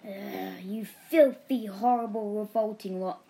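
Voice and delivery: appalled neighbour's growling outburst; growling disgust. A narrator's reading. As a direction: spit out each insult with loud disgust. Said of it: "made a strange growling noise".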